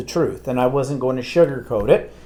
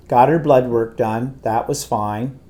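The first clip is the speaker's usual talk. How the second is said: usual speaking voice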